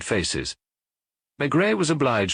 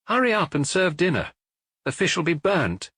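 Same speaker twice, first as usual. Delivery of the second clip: higher, called-back orders from Otard on her way out; calling out; 9 semitones higher than usual. A narrator's reading. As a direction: call over your shoulder to the maid. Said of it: "call out to Irma".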